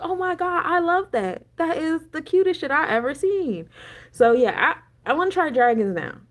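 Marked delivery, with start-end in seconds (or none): 0.0-3.7 gushing. Retold or imitated. Own gushing reaction retold.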